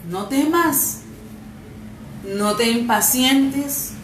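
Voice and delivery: loud, fervent scripture reading; preaching at full force. Acted. Preacher reads Isaiah forty-four to the congregation, fervent. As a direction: proclaim the verses like a promise from heaven.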